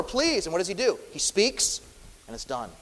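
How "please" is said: raised, urgent plea for rescue; pleading. Retold or imitated. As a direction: beg him to wake and save them. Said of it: "They're pleading over and over".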